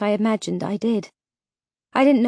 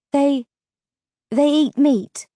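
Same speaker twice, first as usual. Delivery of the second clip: higher, halting question, mildly stunned; breathless; 5 semitones higher, 4 dB louder, slower than usual. A narrator's reading. Vega questions Petra about flesh-eaters, mildly breathless. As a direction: falter on the first word, then ask in alarm. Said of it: "I asked breathlessly".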